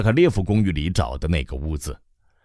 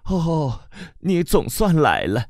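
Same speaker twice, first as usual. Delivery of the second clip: 更高更慢, 又惊又喜; 像母亲终于盼到儿子; 高兴的母亲; 8 semitones higher, slower than usual. Acted. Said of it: "他高兴地结结巴巴地说". (母亲又惊又喜、略带哽咽)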